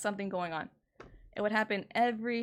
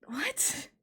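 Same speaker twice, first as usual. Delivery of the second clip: a breathless, disbelieving whisper; stunned whisper; 7 semitones higher than usual. Real, not acted. Told she made him feel bad, a stunned whisper. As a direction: barely get the word out, stunned.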